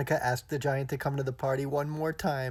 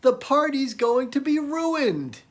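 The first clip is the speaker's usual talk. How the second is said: high, loud alarmed cry; partygoers screaming; 12 semitones higher, 7 dB louder, slower than usual. Acted. Party guests scream as rain starts, high loud alarm. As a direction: shriek out that the party is ruined. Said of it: "he heard everybody scream out".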